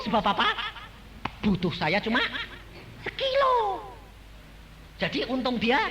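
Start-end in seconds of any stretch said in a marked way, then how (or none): 3.1-3.9 high punchy exclaim. Acted. Rhetorical punchline.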